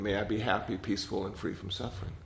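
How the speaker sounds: soft, gentle recitation of a blessing; softly reciting loving kindness phrases; recited prayer. Shown as a demonstration. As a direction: murmur it like a quiet prayer. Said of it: "just to recite that over and over".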